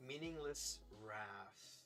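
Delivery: ordinary talk, nothing marked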